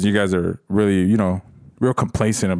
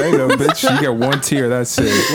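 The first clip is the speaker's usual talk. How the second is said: sassy mock retort; 4 semitones higher, 3 dB louder, slower than usual. Repeats mock retort, louder.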